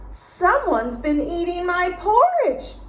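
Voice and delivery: high, loud mock shriek; Mama Bear raising the alarm, high. A narrator's reading. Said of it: "she shrieked".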